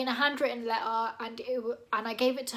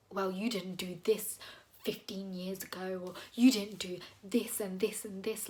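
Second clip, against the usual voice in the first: low, mock-petty whine; 4 semitones lower, 6 dB quieter than usual. Imitating a petty friend listing old grievances, mock-sneering. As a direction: sneer it back like a petty grudge. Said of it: "that's so petty".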